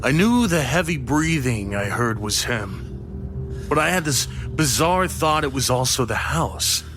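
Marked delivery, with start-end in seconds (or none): none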